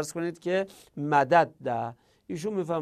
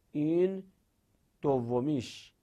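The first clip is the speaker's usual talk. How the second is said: ordinary talk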